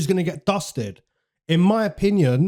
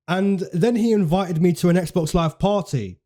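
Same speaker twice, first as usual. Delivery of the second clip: ordinary delivery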